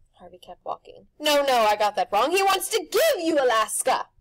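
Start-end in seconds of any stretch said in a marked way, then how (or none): 1.2-4.1 loud, high showman pitch; pushy grinning tempter; Rictus's brash voice. A narrator's reading. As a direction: sell it like a carnival barker. Rictus relaying phone call.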